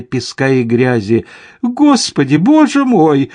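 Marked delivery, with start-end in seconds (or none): 1.6-3.3 very high, louder cry of alarm; panicked exclamation. A narrator's reading. Lady Cameron as the pack bears down, panicked exclamation. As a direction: wail it as panic mounts. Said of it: "опять воскликнула леди Кэмерон".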